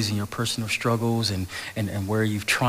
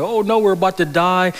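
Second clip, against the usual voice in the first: high, loud mock panic; 8 semitones higher, 6 dB louder than usual. Disciples in the storm boat, mock panic, high and loud. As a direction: play the scared disciples, half in jest. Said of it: "they're fearful and they're scared".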